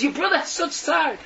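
high, distressed outburst; anguished report. Acted. Messenger bird tells the sister of her brother's suffering, anguished. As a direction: break the bad news urgently, pitched high.

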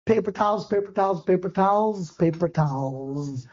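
fading as the stomach drops; sinking dismay. Retold or imitated. My stomach dropped.

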